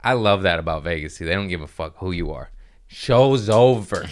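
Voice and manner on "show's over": loud, drawn-out announcement; brusque order, stretched and loud; club enforcer. Acted. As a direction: call it out slowly, cutting the show off. Acts out club ending show.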